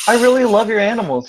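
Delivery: beaming with excitement; delighted guest gushing. Acted. Sky gushing to Jasper.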